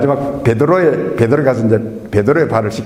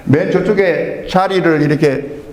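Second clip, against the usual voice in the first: ordinary talk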